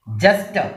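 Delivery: Jester with its first syllable stressed. Shown as a demonstration. Teacher modelling the word, stress on first syllable of jester. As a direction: hit the start of jester. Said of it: "first syllable ആണ് stress".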